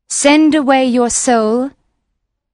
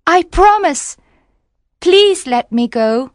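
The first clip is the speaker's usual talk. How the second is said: much higher, louder mermaid, pleading; crying mermaid; 11 semitones higher, 4 dB louder than usual. Acted. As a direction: promise quickly, high and begging. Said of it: "cried the mermaid".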